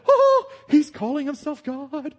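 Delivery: the penny drops in alarm; an outraged crowd suddenly getting it; shocked realisation. Retold or imitated.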